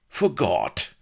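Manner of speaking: high, falling scornful echo; scoffing. A narrator's reading. Blood disbelieves the castaway's excuse, scoffing. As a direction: throw the word back with scorn. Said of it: "scoffed Blood".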